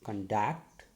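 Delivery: conduct, second syllable stressed and lifted. Shown as a demonstration. Teacher demonstrating a stressed syllable to students, conduct stressed on DUCT. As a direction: put the weight on DUCT in conduct. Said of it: "I am giving stress on a particular syllable or sound, D".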